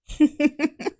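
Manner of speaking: a sharp disbelieving laugh, high; bitter high laugh. Real, not acted. Speaking to critics, a bitter high laugh. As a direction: scoff with a laugh.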